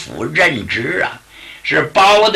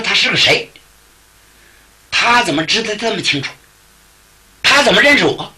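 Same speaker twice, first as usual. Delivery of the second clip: higher, quicker alarmed questions; startled inner thought; 5 semitones higher, faster than usual. Acted. Yunrui inwardly startled that the Taoist knows him, higher and quick. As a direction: wonder in alarm how he knows.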